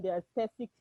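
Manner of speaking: ordinary talk, nothing marked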